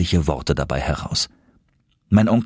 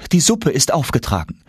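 higher, polite maid's announcement; lightly voiced housekeeper; 7 semitones higher than usual. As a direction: call the household to table. Maid Martha announces lunch.

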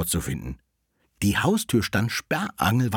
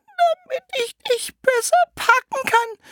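wolf as grandmother; 6 dB louder than usual. Acted. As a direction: drag it out in a squeaky fake granny voice. Wolf posing as grandmother answers.

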